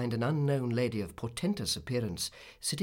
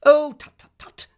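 high, loud burst, then quick tuts; impatient, hasty scolding; hurried tutting; 15 dB louder than usual. A narrator's reading. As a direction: cut off the crying with brisk tuts. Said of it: "said Miss Betsy in a hurry".

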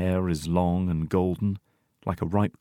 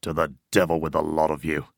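low-pitched grumbling curse; snarl; 4 semitones lower than usual. A narrator's reading. Old man gives in over his coffee, low snarl. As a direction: curse them all in a low growl. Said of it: "snarls the old man".

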